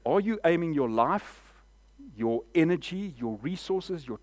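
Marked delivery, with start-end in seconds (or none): none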